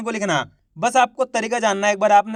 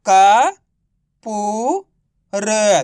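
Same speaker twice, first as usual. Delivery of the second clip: syllable by syllable; 5 dB louder, much slower than usual. Shown as a demonstration. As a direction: sound out का, पू, र separately, each drawn out. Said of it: "तो मिलके बन जाएगा". (का, पू, र को अलग-अलग खींचकर)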